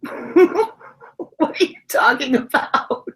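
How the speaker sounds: high, very slow, laughing disbelief; incredulous, laughing. Retold or imitated. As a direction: stretch it out while cracking up. Laughing retelling of her retort.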